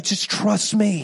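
put-on slow pleading plea to a child; begging parent. Parent begging child, slow pleading. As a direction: beg a stubborn kid to trust you. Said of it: "You, like, beg".

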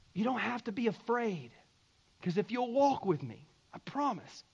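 soft, tender promise; gentle reassurance. Acted. Jesus reassuring followers in life's storm, softly. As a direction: drop the volume and comfort them.

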